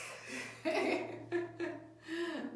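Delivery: laughing. Shown as a demonstration. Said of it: "This is me".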